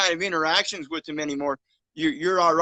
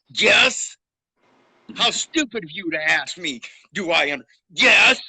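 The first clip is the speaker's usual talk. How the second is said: loud, scornful yes with a sharp rise; angry reenactment of a defiant courtroom answer; belligerent reply; 2 semitones higher, 7 dB louder than usual. Retold or imitated. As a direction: throw the words back at the judge in contempt. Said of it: "in all my belligerence".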